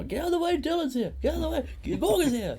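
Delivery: very high, loud squealing voice; mock excited family. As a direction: shriek it as if rushing past to greet someone. Voicing family shoving past him.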